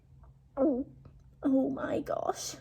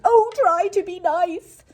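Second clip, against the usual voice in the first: whimpering lion; 7 semitones higher, 7 dB louder than usual. Acted. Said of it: "he whimpered".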